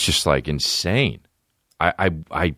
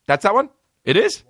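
surprised. Real, not acted. Surprised at the song.

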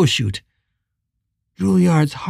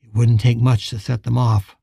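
ordinary delivery

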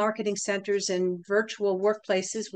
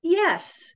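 "Yes" pitched high after a pause; inflected agreement; 7 semitones higher, 3 dB louder than usual. Shown as a demonstration. Psychologist agreeing with the customer's no, high inflected "Yes". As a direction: agree on a lifted, higher "Yes". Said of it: "Listen for the pauses and the inflection".

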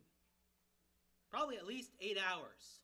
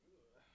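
disgusted ugh; 7 semitones lower, 20 dB quieter than usual. Retold or imitated. People disgusted by the blood.

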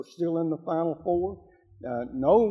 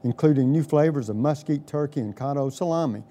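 louder, punchy sponsor ad read; 1950s ad; 5 dB louder than usual. Acted. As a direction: sell it like a radio commercial. Host reads sponsor ad.

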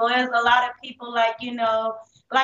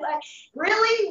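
incredulous; 8 semitones higher than usual. Retold or imitated. Scoffing at talk of God.

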